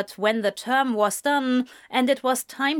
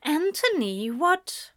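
higher, startled question; surprised; 7 semitones higher, slower than usual. A narrator's reading. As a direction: caught off guard at the door. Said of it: "a very surprised Cynthia".

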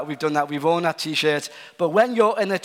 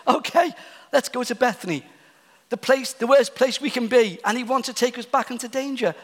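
higher, mocking cynical voice rising to a scoff; sarcastic pessimist; 6 semitones higher than usual. Thomas mocking the trip back to Bethany, sarcastic. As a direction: play the gloomy skeptic, dripping sarcasm. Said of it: "You can hear the sarcasm and the cynicism in his voice".

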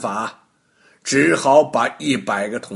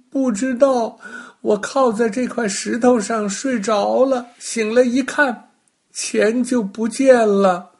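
put-on high, louder child voice, tearful and whimpering; a small boy sobbing out his story, much higher; crying little boy; 11 semitones higher, 5 dB louder than usual. Little boy tells Baogong his money vanished, high tearful child voice.

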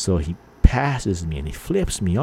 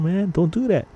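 higher-pitched mock panicked plea; friend freaking out; 10 semitones higher than usual. Friend begging the driver to stop tailing, mock panicked. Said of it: "like, freaking out".

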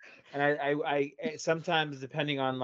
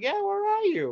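very high, mock-innocent question; sly teasing. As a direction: bait her knowingly. Teasing question, pitch jump.

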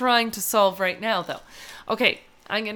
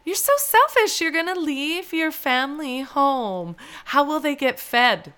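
imitating a scolding nail tech; 6 semitones higher than usual. Retelling the nail tech's scolding, high and hammed-up. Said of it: "And she goes".

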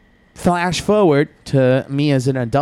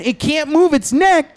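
normal talking voice

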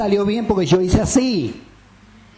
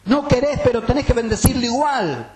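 higher, firm self-rebuke; self-scolding; 8 semitones higher than usual. Retold or imitated. Preacher voicing a man scolding his own soul, higher and firm. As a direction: scold yourself into praising God. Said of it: "se retaba a sí mismo y decía".

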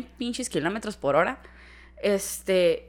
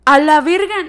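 high, loud burst of shock; startled exclamation; 6 semitones higher, 9 dB louder than usual. Retold or imitated. Friend hears the screaming, startled outburst. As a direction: blurt it out in retold alarm.

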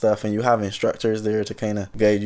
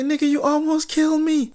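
very high, outraged squeak at his friend; indignant high retort. Retold or imitated. To Brother Darkness after nearly drowning, very high indignant retort. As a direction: screech it in disbelief.

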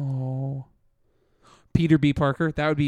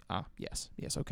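breathy whisper of awe; 7 semitones lower, 15 dB quieter than usual. Retold or imitated. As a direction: whisper it in quiet wonder. Imagined viewer reaction.

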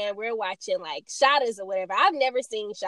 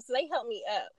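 ordinary delivery

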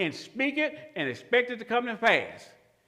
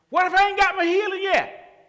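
doubting believer; 10 semitones higher, 5 dB louder than usual. Acted. Doubter's question voiced by preacher.

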